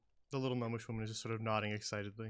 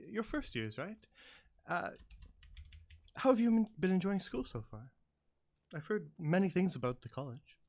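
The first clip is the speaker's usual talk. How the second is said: enthusiastic gnome woman; 6 semitones higher than usual. Acted. Gnome visitor quizzes the first years, higher and enthusiastic. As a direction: gush at the students like a thrilled fan. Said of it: "enthusiastically and says".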